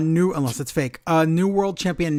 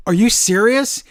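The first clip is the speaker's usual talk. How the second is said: higher, louder mock disbelief at the crowd; mock incredulous; 6 semitones higher, 7 dB louder than usual. Podcaster imitating a wrestler mocking the crowd, mock incredulous. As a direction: toss it at the crowd like a heel who can't believe it. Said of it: "and she's like".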